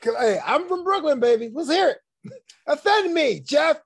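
loud, high, hammed-up Brooklyn accent; New York accent. Goading the co-host to do the voice, mock Brooklyn accent. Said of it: "I got the New York accent".

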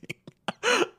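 high cackle; high laugh. Real, not acted. Laughing at her own joke, high cackle.

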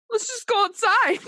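high-pitched urging, laughter close behind; mock-urgent high voice; retold nervous insistence. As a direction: squeak out an awkward plea. Retold urgent plea to boss.

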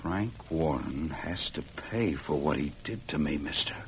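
quiet, low and deadly serious; grim low vow. Acted. Joe telling the masked man he'll make Warren pay, low and grim. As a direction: say it low with cold resolve.

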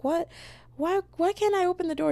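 very high-pitched mock bewilderment; imitated confused calling. Daughter questions her dad's warning, imitated high confused call.

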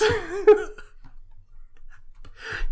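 wheezy laugh; helpless breathless laugh; high wheezing laugh. Real, not acted. Cracking up at the co-host's face, helpless wheezing laugh.

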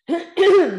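loud rough throat clear. Real, not acted. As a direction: clear the scratchy morning throat. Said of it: "sorry, my morning voice".

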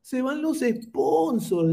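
very high, whining mock lament; mocking critics. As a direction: wail like fretful complainers, stretching the words. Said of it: "decían".

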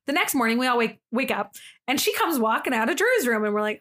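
normal talking voice

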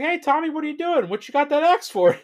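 very high, loud, cheerful voice; mock Gary. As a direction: call it out high and clueless. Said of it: "he's like".

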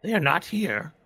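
high creepy voice talking to itself; paranoid, questioning dark creature; eerie creature voice. Acted. Creature talks to Toby and party.

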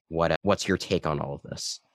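ordinary talk, nothing marked

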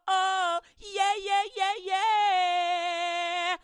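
loud, high, whooping party voice; sin bursting in, hollering hello; sin shouting for joy. Acted. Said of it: "sin just went".

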